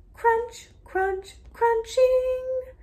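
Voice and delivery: loud, slow, rising crunch, crunch; sound effect words. Acted. Teacher reading to a child, acting out the crunching. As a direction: make the crunch sound playful and big.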